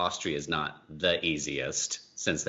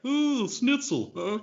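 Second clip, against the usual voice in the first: much higher put-on comedian voice, hammed up; mock stand-up bit, voice pitched way up; cheesy stand-up comic; 14 semitones higher, 6 dB louder than usual. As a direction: ham it up like a corny club comic working the room. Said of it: "that was my stand-up there".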